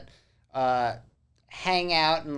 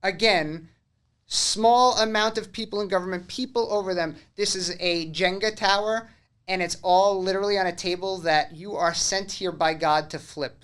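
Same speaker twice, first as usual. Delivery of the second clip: loud, high-pitched rallying cry; rallying speech; 6 semitones higher, 8 dB louder than usual. Pretend. Host urging people worldwide to stand up, loud rallying speech. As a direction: exhort the crowd at full force.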